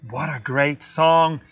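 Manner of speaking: usual speaking voice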